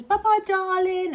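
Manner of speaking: high, loud, slow sing-song goodbye; cheery bus driver. Retold or imitated. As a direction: call out a friendly farewell. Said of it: "and the bus drivers".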